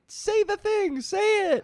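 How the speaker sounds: very high, eager mock crowd voice; clamoring crowd. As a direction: imitate fans pleading for the catchphrase. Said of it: "where everybody's like".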